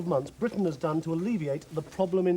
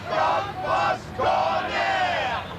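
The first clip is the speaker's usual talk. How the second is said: high, slow, stretched shout of the catchphrase; 12 semitones higher, much slower than usual. Acted. Tourist yells the catchphrase back across the street. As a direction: bellow it out like a slogan.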